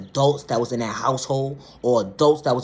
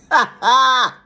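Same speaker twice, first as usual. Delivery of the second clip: short, loud, high laugh; incredulous laugh; 10 semitones higher, 9 dB louder than usual. Real, not acted. Reacting to a child told to shut up, incredulous laugh. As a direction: bark out a disbelieving laugh.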